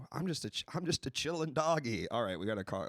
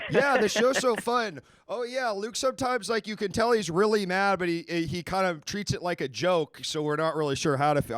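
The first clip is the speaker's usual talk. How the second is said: higher, louder sarcastic parody of a cheerful fan; mock enthusiastic fan; 5 semitones higher, 4 dB louder than usual. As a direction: gush in mock delight, easing off toward the end. Host voices fake enthusiastic fan reply.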